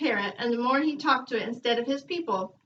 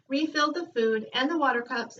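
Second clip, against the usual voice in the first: ordinary delivery, nothing marked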